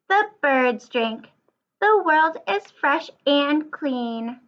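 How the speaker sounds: slow, lively storybook read-aloud; reading to children. Acted. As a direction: read it like story time for little kids. Reads picture book to class.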